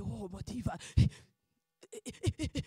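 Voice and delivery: ordinary delivery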